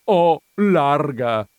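high, slow wailing correction; moaning. A narrator's reading. Countess correcting the visitor's vowel, moaning. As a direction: spell out the vowel in anguish. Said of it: "gemé".

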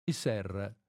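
ordinary delivery

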